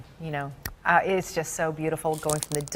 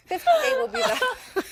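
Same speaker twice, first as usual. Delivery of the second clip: anchor stifling giggles; slower than usual. Real, not acted. Giggling restart of forecast.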